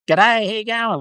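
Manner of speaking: high-pitched, exaggerated Australian twang; broad Aussie accent. Demonstrating a rural Australian greeting in a broad Aussie accent. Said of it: "that's a, sort of a broad accent".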